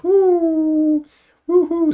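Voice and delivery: very high, loud woo; high-pitched sustained cheer; celebratory whoop. Real, not acted. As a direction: whoop with delight. Celebrating a fifty dollar donation.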